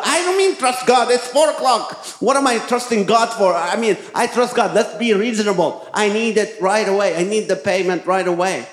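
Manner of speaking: high, exasperated arguing. Retold or imitated. Husband argues with his wife in the car about the rent, exasperated. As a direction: pitch up, pleading to be reasonable.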